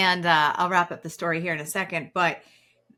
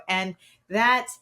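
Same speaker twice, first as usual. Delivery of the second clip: usual speaking voice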